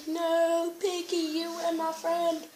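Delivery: hammed-up sorrow for a friend; mock lament.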